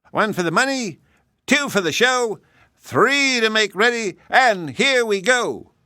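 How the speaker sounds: high, sing-song starting count; race count-off. Acted. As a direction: call the start like a game.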